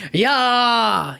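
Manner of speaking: hero's charge, imitated without full volume; imitated battle yell. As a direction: act out a fighter's drawn-out yell. Hero charging at the lion.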